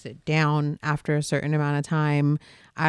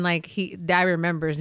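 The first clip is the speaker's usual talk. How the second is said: ordinary talk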